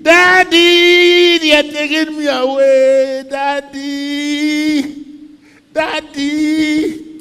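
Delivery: high desperate screams for daddy; anguished cry. Acted. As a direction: cry out to a father across the village. Joseph dragged away calling father.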